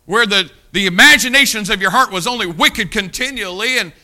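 booming, high preacher's cry; preaching at full force. Acted. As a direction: thunder it out from the pulpit.

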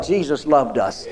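ordinary delivery

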